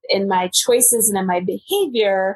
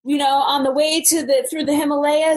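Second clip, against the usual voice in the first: carefree younger self; 6 semitones higher than usual. Retold or imitated. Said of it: "But I remember thinking".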